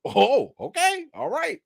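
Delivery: high, startled oh okay; surprised. Retold or imitated. Retelling startled reaction at the window. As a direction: caught off guard by what he sees. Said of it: "And I'm like".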